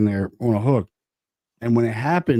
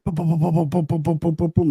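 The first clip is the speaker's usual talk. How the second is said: rapid string of higher-pitched booms; imitated gunfire; 6 semitones higher than usual. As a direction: rattle off booms like a volley. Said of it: "like a volley of fire".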